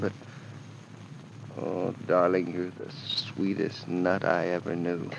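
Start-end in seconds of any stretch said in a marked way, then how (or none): 1.6-5.1 low, soft, fond endearment; tender. Acted. Husband to wife after a small quarrel, tender. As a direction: murmur it lovingly to your darling.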